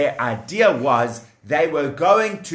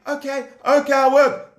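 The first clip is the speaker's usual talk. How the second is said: nervous slave; 10 semitones higher than usual. Acted. Slave answering the taskmaster.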